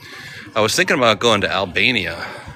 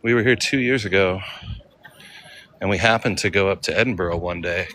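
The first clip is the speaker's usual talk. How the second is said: normal talking voice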